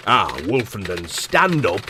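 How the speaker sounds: low, slow, lordly boss voice; pompous boss. Acted. Lord Director summons the narrator, lightly voiced pompous boss. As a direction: lord it over him.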